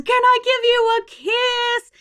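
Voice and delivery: high, cooing grandparent voice; gushing, sing-song request for a kiss; doting grandparent. Acted. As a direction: coo it sweetly to a toddler. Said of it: "the grandparent says".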